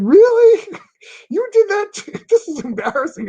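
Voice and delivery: high, loud incredulous cry; shocked disbelief. Retold or imitated. Caught off guard by his boss's prank, high incredulous cry. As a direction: squeal it in mock disbelief.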